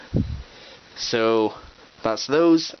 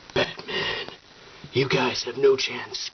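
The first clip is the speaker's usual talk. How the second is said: Batman voice; slower than usual. Pretend. Toy Batman appears at gate.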